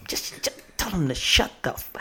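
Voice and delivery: breathy whisper, cut off on the swear. Real, not acted. Hiding a cuss from listeners, whispering. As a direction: drop to a whisper to hide the curse. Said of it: "I gotta whisper".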